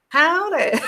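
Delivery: high-pitched, badly faked American greeting; jokey mock American twang; bad American accent. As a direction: attempt an American accent and giggle at it. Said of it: "I can't do an American accent".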